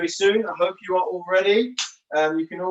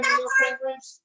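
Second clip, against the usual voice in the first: much higher, bright encouragement; excited cheer. Real, not acted. Coach cheering the children waddling as penguins, excited.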